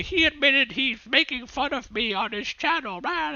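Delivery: high whiny voice mocking Wally. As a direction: squeak it out like a sore loser. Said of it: "But he's gonna say".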